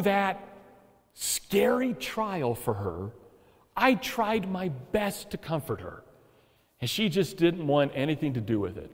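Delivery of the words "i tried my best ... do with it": normal talking voice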